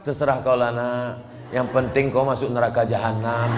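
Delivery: low, dropped mother voice, shrugging; indifferent mother. Acted. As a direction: mutter it like a careless mother.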